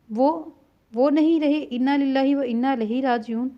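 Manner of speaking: plain reading voice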